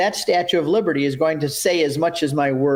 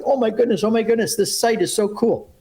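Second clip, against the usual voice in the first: higher, awed exclamation; amazed; 6 semitones higher than usual. Retold or imitated. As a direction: act out the pilgrims' amazement. Pilgrims amazed at the site.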